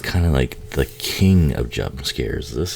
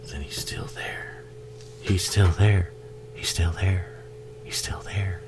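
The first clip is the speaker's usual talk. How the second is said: soft, creeping whisper; suspenseful whisper; 4 semitones higher, 8 dB quieter than usual. Real, not acted. Watching the killer lurk, whispering with dread. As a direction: build dread under the breath.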